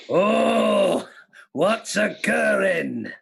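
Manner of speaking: slow, drawn-out mock catchphrase; catchphrase impression. Host performs a disguised catchphrase for a quiz, slow put-on voice. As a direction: stretch every word out in a put-on voice. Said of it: "famous catchphrase this is".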